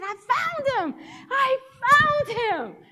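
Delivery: high, loud, slowing triumphant cry; elated announcement. Retold or imitated. Changed patient announcing he found God, elated cry. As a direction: shout it in triumph, stretching the repeat.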